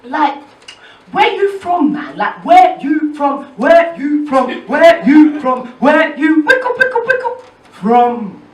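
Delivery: imitating a stranger's loud pestering question; insistent asking. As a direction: hammer the question again and again. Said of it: "So he's like".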